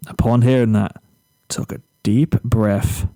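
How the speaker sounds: plain reading voice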